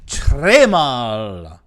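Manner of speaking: slow, loud, put-on French accent; français exagéré, lent et fort; hammed-up French. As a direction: ham up the French. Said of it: "je parle français très mal".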